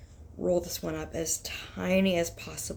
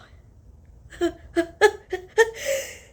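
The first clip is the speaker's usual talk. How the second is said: high teary laugh; 8 dB louder than usual. Real, not acted. Laughs after a quick sneeze.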